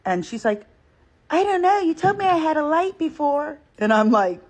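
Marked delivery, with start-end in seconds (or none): 1.3-3.6 high, sugary put-on girl voice, imitating her; mock the fake cutesy tone, pitched way up. Teller imitates the girl's fake cutesy voice. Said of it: "modulating her voice to try to make it seem more, like, cutesy".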